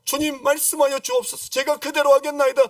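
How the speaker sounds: high, loud mock-devout plea; fervent prayer. Believer promising God to obey, fervent prayer. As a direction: pray it out high and forceful.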